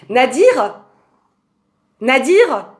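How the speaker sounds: loud high call to another room; yelling across the room. Real, not acted. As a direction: call out to someone far away. Calls friend from other room.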